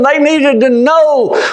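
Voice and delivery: ordinary talk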